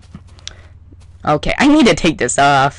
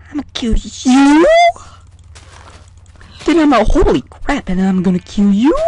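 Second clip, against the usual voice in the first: playful threat; 5 semitones higher, 3 dB louder than usual. Pretend. Threatening animals in game.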